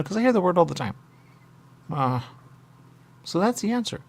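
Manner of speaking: usual speaking voice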